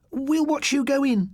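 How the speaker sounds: put-on girl staying safely outside, high and slow; girl's voice. A narrator's reading. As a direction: hang back nervously. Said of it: "said Dawn".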